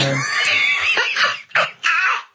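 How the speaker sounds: very high squealing burst of laughter; shrieking laugh. Real, not acted. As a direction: lose it completely. Laughs mid-story.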